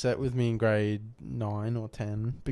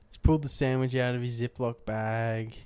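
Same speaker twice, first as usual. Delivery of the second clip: normal talking voice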